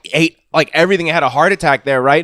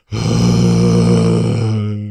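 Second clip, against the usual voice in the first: very low, long groan; imitated half-conscious groan. Willie seen through the cracked door, imitated groan. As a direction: drone out a low, wordless groan. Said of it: "he's just like".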